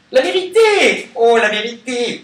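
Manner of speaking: high, loud cry of revelation; loud exclamation. Real, not acted. Card reader hailing the card that falls, loud exclamation. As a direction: sing out the word at full voice, held high.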